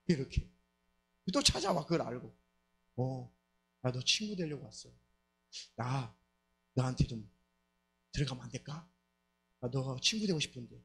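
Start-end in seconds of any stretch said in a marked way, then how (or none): 3.0-10.7 pleading demon. Retold or imitated. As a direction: coax your way inside. Demon asks to come in.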